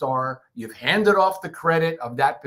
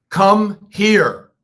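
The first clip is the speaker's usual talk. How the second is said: "Come here" called out loud and high, stretched on "here"; a commanding shout of "Come here"; 5 semitones higher, 9 dB louder than usual. Shown as a demonstration. Teacher demos a loud, high "Come here". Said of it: "These are all different".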